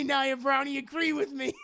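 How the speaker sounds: ordinary talk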